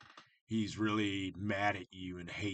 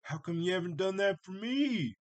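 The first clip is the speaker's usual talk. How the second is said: high, loud, indignant complaint; mock-offended sibling protesting unfairness; jealous brother; 10 semitones higher, 6 dB louder than usual. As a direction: whine it like the left-out older brother. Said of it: "the brother was like".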